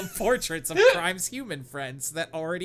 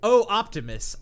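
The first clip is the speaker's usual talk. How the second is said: mock dramatic cry; 7 semitones higher, 9 dB louder than usual. Reading fanfic dialogue dramatically.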